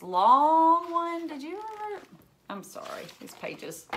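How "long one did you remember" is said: playful quiz. Pretend. Teasing quiz to kids.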